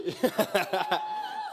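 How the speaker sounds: high, loud burst of real laughter. Real, not acted. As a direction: burst out laughing mid-introduction. Laughs while introducing friend Pete.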